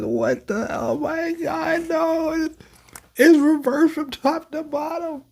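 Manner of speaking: high, strained mock shout; frantic mock outcry, high and pinched; hammed-up panicked reading. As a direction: yelp it out like a panicked scientist. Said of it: "don't make me read this like this".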